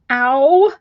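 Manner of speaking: sudden real ow of pain; pain cry. Real, not acted. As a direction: yelp from a sharp jab. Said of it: "I stabbed myself".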